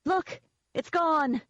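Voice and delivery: high-pitched, thrilled outburst; excited. Acted. Narrator showing the others the dead amulet, excited. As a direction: cry out for everyone to see.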